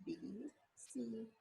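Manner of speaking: soft unvoiced whisper of B, C; hushed spelling; whispered letters.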